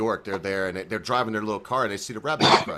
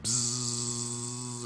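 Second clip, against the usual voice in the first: soft, long electric buzz; sustained softer buzz; buzzing noise; 8 dB quieter than usual. Acted. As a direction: imitate a small cart whirring up. Imitating golf cart.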